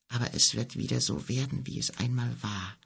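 soft, hushed closing words; a quiet, sad promise; quietly. A narrator's reading. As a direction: let the sentence fade away quietly. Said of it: "schloss er leise".

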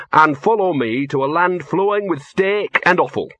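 imitating a solemn spirit guide; big dog of death. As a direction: act out a grand dog calling someone home. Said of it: "this big dog that trots up to you and says".

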